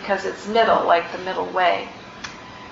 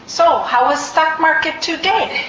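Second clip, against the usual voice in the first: high, loud, laughing imitation of a Tibetan teacher's accent; mock the teacher's Tibetan-accented English, laughing; teacher's accent, poorly imitated; 7 semitones higher, 5 dB louder than usual. Imitating the teacher's greeting to retreat students, in a Tibetan accent. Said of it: "which I'll try to imitate but do poorly".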